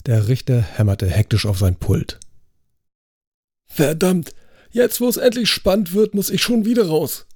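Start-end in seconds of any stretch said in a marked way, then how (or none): 3.7-7.3 hoch und gereizt schimpfen; schimpfend. A narrator's reading. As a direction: der Richter schimpft hoch und genervt los. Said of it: "schimpfte er". (der Richter flucht entnervt, weil er raus muss, schimpfend)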